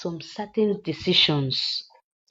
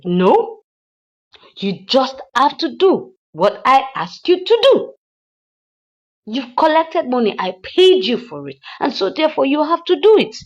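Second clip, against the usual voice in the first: loud, higher mock rebuke; imitating angry rebuke; 8 semitones higher, 9 dB louder than usual. Madam Uchechi rejecting the hitman's excuse, loud mock anger. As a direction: cut him off in retold anger.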